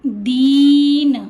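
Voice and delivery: दीन very slow and loud, ee held long; दीन को लंबा खींचकर. Shown as a demonstration. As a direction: stretch the ee in दीन. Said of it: "इसका उच्चारण क्या होगा?".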